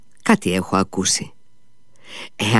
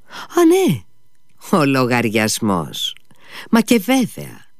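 high startled yelp then a slow mumble; sudden remembering; 4 semitones higher, slower than usual. Acted. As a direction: jump up in pitch as it comes back to you. Mitya suddenly remembering the bill.

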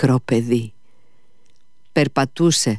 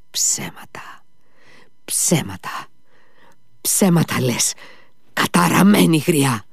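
angry hiss building to a raised cry; enraged; 6 semitones higher than usual. A narrator's reading. Mitya accuses the old woman of lying, enraged. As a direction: start whispered, end higher in fury. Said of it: "ούρλιαξε ο Μήτια".

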